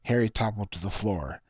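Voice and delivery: ordinary delivery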